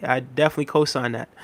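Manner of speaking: ordinary talk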